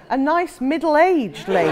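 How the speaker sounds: high, loud Geordie correction; thick Geordie accent. Retold or imitated. Young woman correcting herself on the phone, thick Geordie accent. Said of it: "in a thick Geordie accent".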